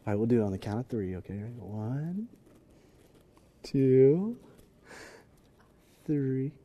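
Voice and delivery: very soft, cooing count; lovesick hushed countdown. Acted. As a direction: count softly, drawing out the wait. Acting out lovers hanging up.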